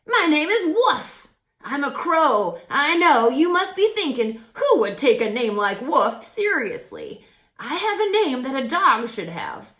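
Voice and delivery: loud, high cheerful crow; crow Woof voice. A narrator's reading. Crow introduces himself to Jazz, loud and cheerful. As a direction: play the chatty friendly crow, loud and bright.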